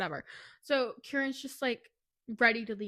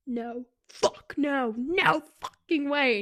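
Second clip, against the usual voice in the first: slow, louder disbelief; outraged denial; 3 semitones higher, 7 dB louder, much slower than usual. Retold or imitated. Kieran watching Ash kiss Avi, slow outraged refusal. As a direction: draw out each no in outrage.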